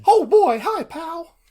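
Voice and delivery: squeaky high cartoon mouse voice, loud and bright; Mickey Mouse. Co-host greets his friend in a high mouse voice. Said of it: "I thought Mickey was gonna say more".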